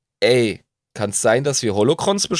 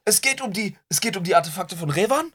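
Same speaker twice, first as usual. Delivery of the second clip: excited; 11 semitones higher than usual. Real, not acted. Sudden excited guess.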